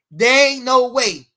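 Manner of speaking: drawn out and loud; slow loud insistence; slow emphatic denial. Real, not acted. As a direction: stretch each word, defiant. Defiant insistence.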